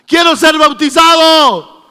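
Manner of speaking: loud, slow, eager demand; eager plea. Acted. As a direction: beg for it with full voice. Pablo asks eagerly. (Pablo, fuerte y lento, suplicando el bautismo)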